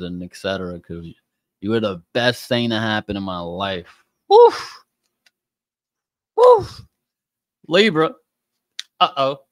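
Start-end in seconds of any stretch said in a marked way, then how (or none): 4.3-6.8 loud, high-pitched "woof"; startled, airy high exclamation; high whoop. Real, not acted. Exclaims at the cards. 7.7-9.4 loud, raised call-out; high, punchy uh-oh warning; playful alarm. Real, not acted. Calls out sign in alarm.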